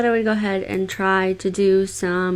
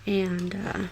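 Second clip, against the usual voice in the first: soft ASMR voice; 6 dB quieter than usual. Acted. Starts the ASMR tingles part.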